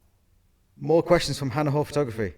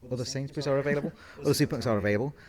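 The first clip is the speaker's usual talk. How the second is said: rushed disclaimer; 3 semitones higher, much faster than usual. Acted. Jokey brand disclaimer.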